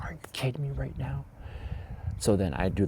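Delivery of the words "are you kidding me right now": whispered disbelief; stunned whisper. Retold or imitated. Teacher sees student still choking, stunned whisper. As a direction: breathe it out in shock. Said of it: "And I'm like".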